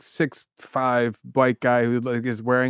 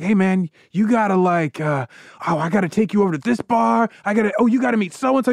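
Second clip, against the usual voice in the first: eager fan; 6 semitones higher than usual. Retold or imitated. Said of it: "He's like".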